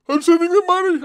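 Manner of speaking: loud, high-pitched exclamation; high yell. Real, not acted. Streamer repeats his vow to send money, high-pitched yell.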